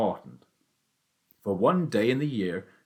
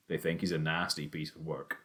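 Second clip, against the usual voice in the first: ordinary delivery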